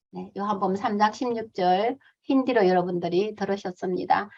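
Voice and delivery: ordinary talk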